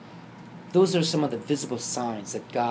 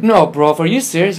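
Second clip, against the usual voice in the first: loud, high incredulous outburst; acted-out astonished exclamation; shocked disbelief; 5 semitones higher, 12 dB louder than usual. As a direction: react to your brother in loud disbelief. Retold shock at brother's news.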